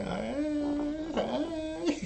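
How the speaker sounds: very high, breathy-sounding mock shy hi; hammed-up bashful woman; flustered greeting. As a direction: caught off guard and flustered.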